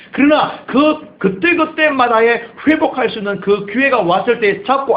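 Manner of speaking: slow rising preacher's push; preaching emphasis. Real, not acted. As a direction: lean into the point like a preacher.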